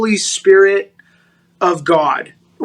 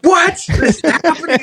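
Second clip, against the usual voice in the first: much higher, bewildered what is happening; baffled; 10 semitones higher than usual. Retold or imitated. Hearing the man knows her church, a high bewildered question. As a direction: act out your own total confusion. Retells his own baffled reaction.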